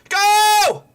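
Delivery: high, loud shriek of hope; excited scream. Real, not acted. Fan screaming as his team breaks forward. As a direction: yell them towards goal.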